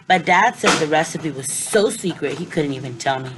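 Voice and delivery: ordinary delivery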